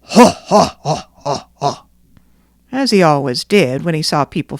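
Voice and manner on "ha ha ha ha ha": a hint of a booming villain's laugh; lightly voiced robber's ha ha; brigand chief laughing. Brigand chief laughing at the fleeing people, lightly voiced. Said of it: "the brigand chief was shouting".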